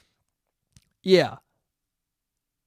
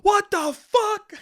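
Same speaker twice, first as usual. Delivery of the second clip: much higher, slow, drawn-out disbelief; shocked outburst; 13 semitones higher, slower than usual. Retold or imitated. Reacting to a seven-hour download time, exaggerated shocked outburst. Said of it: "and I went".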